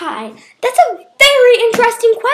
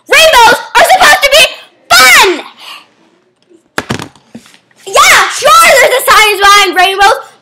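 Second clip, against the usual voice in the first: loud, higher rant; mock exasperated rant; 5 semitones higher, 5 dB louder than usual. Host ranting to the guest about rainbows. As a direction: shout the answer in exasperation.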